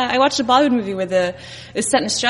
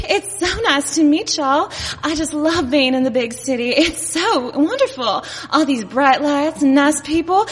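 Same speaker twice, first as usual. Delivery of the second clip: higher-pitched, gushing Texas drawl; Dallas accent; 5 semitones higher, slower than usual. Acted. Dallas newcomer wowed by the big city, gushing Texas accent. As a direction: gush slowly in a sweet Dallas twang. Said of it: "I'm from Dallas".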